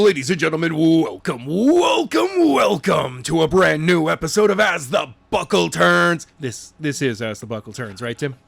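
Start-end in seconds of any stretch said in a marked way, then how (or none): 0.0-6.3 loud, booming show-opening welcome; show announcer. Acted. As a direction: stretch and belt out each welcome like a ring announcer.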